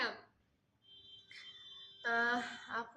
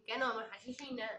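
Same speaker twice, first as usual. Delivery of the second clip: usual speaking voice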